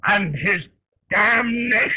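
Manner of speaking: rising to a furious cry on damnation; bitter curse. Acted. Toast cursing Lincoln, rising cry.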